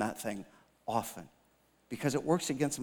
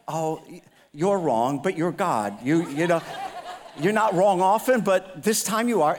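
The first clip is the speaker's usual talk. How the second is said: cheeky pushback; 4 semitones higher, 7 dB louder than usual. Retold or imitated. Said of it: "I'm like".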